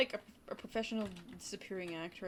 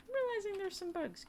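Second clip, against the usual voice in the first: high-pitched, thin-volume singsong complaint; high put-on voice; 12 semitones higher than usual. Streamer telling viewers about bugs, high put-on voice. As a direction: repeat it way up high.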